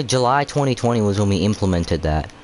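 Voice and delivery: normal talking voice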